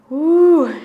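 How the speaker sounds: loud high cheer; cheering woo. Real, not acted. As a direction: whoop of triumph. Coach cheering after the set.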